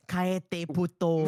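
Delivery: mock shush. Telling someone to shut up.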